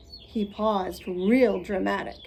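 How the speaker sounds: plain reading voice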